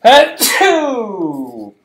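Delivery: high, explosive sneeze; loud sneeze. Real, not acted. Host sneezing in the middle of trivia, loud. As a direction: let out a big drawn-out achoo.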